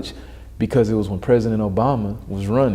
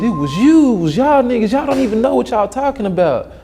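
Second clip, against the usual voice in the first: much higher, louder shrill mimic; 12 semitones higher, 4 dB louder than usual. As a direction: mimic her ranting from the balcony. Racist neighbor yelling from balcony.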